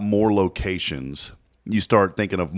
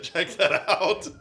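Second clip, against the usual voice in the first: words through laughter; cracking up; laughing; 11 semitones higher, 5 dB quieter, much slower than usual. Real, not acted. Laughing at the logo.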